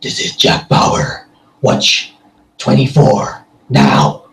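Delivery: slow, gravel-throated trailer voice-over; dramatic, drawn-out trailer announcer; movie trailer voice. Shown as a demonstration. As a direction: growl it slowly like a film trailer. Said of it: "for a trailer type thing".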